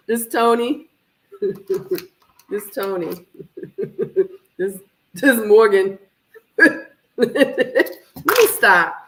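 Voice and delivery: real laughter breaking through the words; cracking up at her own joke; laughing. Real, not acted. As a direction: giggle through the line.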